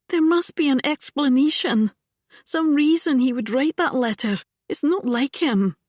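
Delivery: put-on young heroine defending her father; insistent young woman. A narrator's reading. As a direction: refuse to believe it, pressing. Ilsa protesting her father's innocence.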